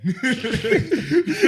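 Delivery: big high cackle; loud burst of laughter. Real, not acted.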